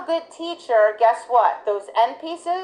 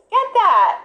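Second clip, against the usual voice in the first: high, louder cue to the dog; excited urging; 9 semitones higher, 4 dB louder than usual. Retold or imitated. Trainer cueing dog to the dumbbell, high urging. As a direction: point and urge it on. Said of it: "And I'm like".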